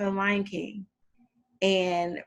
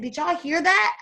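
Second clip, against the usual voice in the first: amazed retold question; 10 semitones higher, 4 dB louder than usual. Amazed student asks classmates, retold.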